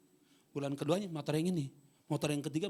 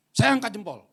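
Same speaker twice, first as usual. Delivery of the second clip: "Saya angkat jempol" repeated louder and higher; 7 semitones higher, 13 dB louder than usual. Shown as a demonstration. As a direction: proclaim it to the whole church. ("Saya angkat jempol" diulang lebih keras)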